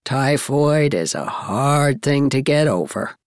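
put-on low, firm older woman; emphatic. A narrator's reading. Miss Cornelia warning about typhoid, emphatically. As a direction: state it low with weight. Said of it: "said Miss Cornelia emphatically".

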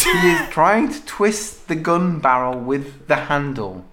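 slowed right down, word by word; slow, deliberate repeat. Real, not acted. Player repeats the odd story line to a baffled friend, slowly and deliberately. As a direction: spell it out slowly for a confused listener.

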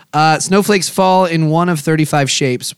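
ordinary talk

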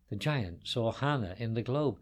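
ordinary delivery

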